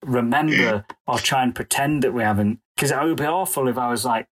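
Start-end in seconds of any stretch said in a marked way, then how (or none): none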